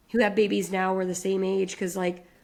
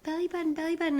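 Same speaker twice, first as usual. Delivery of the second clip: put-on high, soft toddler chant; sing-song like a toddler; child voice; 10 semitones higher, 3 dB quieter, much faster than usual. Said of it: "he'll be like".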